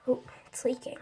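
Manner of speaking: soft surprised whisper. Real, not acted. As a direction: whisper it as you notice. Noticing slime leaking.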